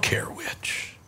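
hushed, slow whisper of indifference. Acted. Paul telling the church he doesn't care which, whispered. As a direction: let it fall to a breathy whisper.

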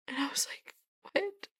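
choked-up whisper; soft, voiceless, overcome; tearful whisper. Real, not acted. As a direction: barely get it out, whispered. Overcome, retelling her shocked reply.

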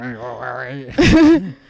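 deep booming belly laugh; whole-body jiggling guffaw; loud guffaw. Shown as a demonstration. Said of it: "Alan is full on jiggling at this point".

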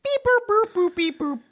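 high chirpy beep boop noises; mock dial tones. Playfully mimicking a phone dialing, high chirps.

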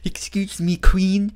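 high, fussy, formal proposal voice; a prim little gentleman asking politely; Augustus Saint Cloud. Retold or imitated. The king proposes to the queen, high and prim. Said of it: "he sounds like Augustus Saint Cloud".

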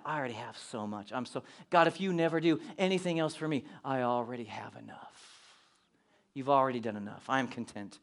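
low, soft and reverent; hushed contented prayer. Acted. Believer telling God he has enough, praying softly and low.

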